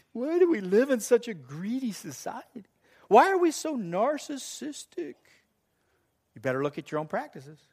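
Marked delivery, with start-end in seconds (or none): 0.1-5.1 high-pitched imitated churchgoer complaint; mock lament.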